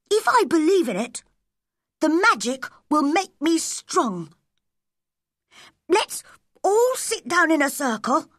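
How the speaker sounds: put-on high-pitched, earnest boy addressing his friends; high character voice, measured phrasing; Colin. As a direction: voice an eager young boy, pitched well up.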